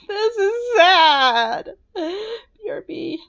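high, loud, slow crying laugh. Real, not acted. Player laugh-crying after killing Astrid, high and loud. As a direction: break into tearful laughter.

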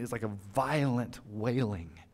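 ordinary delivery

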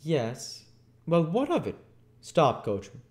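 high, brisk, urgent voice; doctor crying out. Acted. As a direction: call to the coachman as a bustling doctor. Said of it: "cried the doctor".

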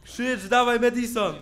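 high, loud frantic urging; urgent yelling. Real, not acted. Player frantically yelling at Madison to scream, loud urging. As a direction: spur her on mid-fight. Urging character during attack.

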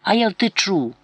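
put-on a stubborn child talking back; defiant little boy's retort; boy's defiance. A narrator's reading. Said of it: "і він сплюнув".

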